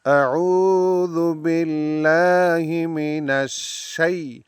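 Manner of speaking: slow, melodic tajweed recitation; Quranic recitation. Shown as a demonstration. Teacher reciting for students to repeat, slow and melodic. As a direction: chant it slowly, drawing out the endings.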